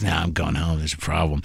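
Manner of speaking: soft deflated mutter; glum reply. Retold or imitated. Comic to waiter, soft glum reply. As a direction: answer flatly, defeated. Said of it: "And I'm like".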